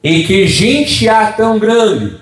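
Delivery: slow, lifted reading of the verse; scripture reading. Acted. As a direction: read the verse out like a preacher, drawn out.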